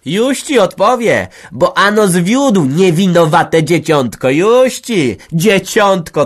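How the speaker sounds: loud, high, slow spitting threat; furious threat. Acted. Women threatening each other in a screaming row. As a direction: threaten her to her face. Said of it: "groziła".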